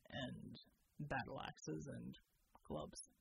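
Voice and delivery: low, steady soldier voice; deep measured military man; Bull's voice. Acted. Soldier Bull reporting to the team, low and steady.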